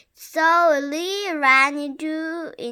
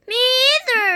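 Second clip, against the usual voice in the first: higher, louder cross reply; 9 semitones higher, 9 dB louder, much faster than usual. Acted. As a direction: snap it back crossly. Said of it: "said Beth crossly".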